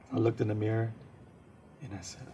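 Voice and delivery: ordinary talk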